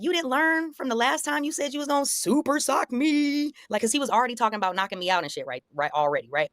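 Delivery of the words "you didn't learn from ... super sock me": high, incredulous goading question; retold taunt. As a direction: pitch it way up, needling an opponent.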